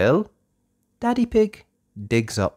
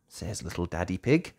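ordinary delivery

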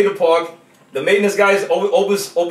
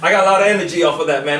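usual speaking voice

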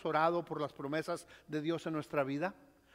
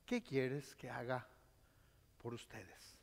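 soft, lower, slow question; gentle Jesus; 9 semitones lower, 13 dB quieter, slower than usual. Acted. Jesus to the blind men, soft and tender. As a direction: ask tenderly.

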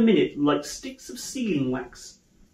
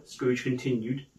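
ordinary delivery

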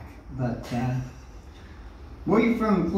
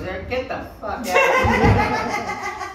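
high, slow, laughing brush-off; dismissive comedian; 12 semitones higher, 4 dB louder, slower than usual. Retold or imitated. Comedian shooing the microphone stand off stage, slow mocking sneer. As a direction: wave the poor thing away with a sneer. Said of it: "every fucking comedian comes up and goes".

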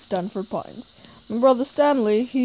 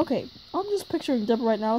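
normal talking voice

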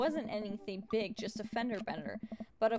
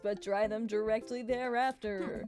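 hammed-up product pitch; grand, over-selling announcer delivery; cheesy announcer; 2 semitones higher than usual. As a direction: pitch it like an infomercial host. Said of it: "do my best cheesy announcer voice".

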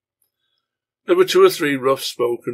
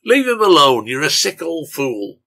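loud, high burst, then easing; angry shout; 4 semitones higher, 8 dB louder than usual. A narrator's reading. Young Slade to the old man in the bar, shouting. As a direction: shout him down with contempt. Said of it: "Frank Slade shouted at the old man".

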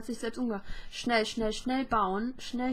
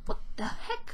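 much higher, breathy squeak; squeaky high; 11 semitones higher, slower than usual. Real, not acted. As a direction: squeak the exclamation way up. Repeated exclamation, squeaky take. (hohes, gehauchtes Quietschen)

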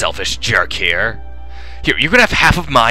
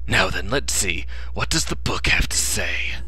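whisper; 4 semitones lower, 8 dB quieter than usual. Acted.

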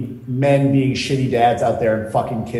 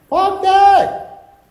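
loud high 'Fuck that'; louder, higher dismissal of sitting on the sidelines; emphatic rejection; 6 dB louder than usual. Real, not acted. Coach rejects complacency emphatically.

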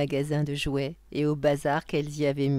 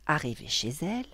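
ordinary delivery

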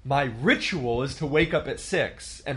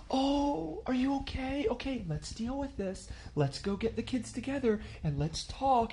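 much higher, soft syrupy reassurance; sweet churchy dad; 11 semitones higher, 3 dB quieter than usual. Retold or imitated. Father in the church foyer soothing his crying child, sugary and high. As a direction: play the too-nice public dad.

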